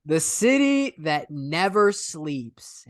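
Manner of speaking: slow, drawn-out proclamation; grand slogan. Pretend. Telling a cohost about New York, grandly slow. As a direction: stretch it out like a tagline.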